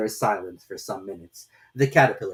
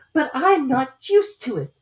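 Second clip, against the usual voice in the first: piteous plea; 7 dB louder, slower than usual. A narrator's reading. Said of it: "in a piteous tone".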